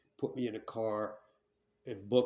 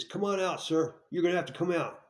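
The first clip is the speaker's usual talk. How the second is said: raised, higher commanding cop voice; cop calling out; 6 semitones higher, 3 dB louder than usual. Retold or imitated. Cop calling a suspect out of the store, raised and commanding. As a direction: order him out of the store like a cop.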